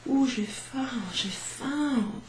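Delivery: high, hammed-up moan of j'ai faim; a teacher miming hunger pangs; acting hungry.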